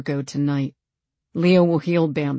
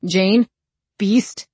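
higher, wary questioning call; tentative questioning, raised pitch; afraid; 4 semitones higher, 4 dB louder than usual. A narrator's reading. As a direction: call up uncertainly, a touch afraid. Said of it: "Sounded afraid".

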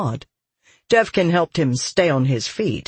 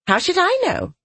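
put-on much higher, childlike retort; petulant voice. A narrator's reading. Voice in soldier's head retorting, high and petulant. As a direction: toss it back in a high voice.

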